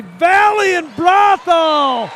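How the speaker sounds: high, loud, drawn-out announcer flourish; booming game hype. Real, not acted. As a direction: belt it out like a big-game promo. Hypes the big football game.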